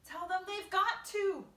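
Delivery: high, slow, emphatic shout; shouting back. A narrator's reading. James answering centipede below, shouting. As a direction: insist loudly, each word weighty.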